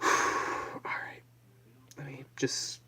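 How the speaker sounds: hushed punchline whisper; mock math answer whispered as a punchline. Real, not acted. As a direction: whisper the payoff. Whispered self-talk.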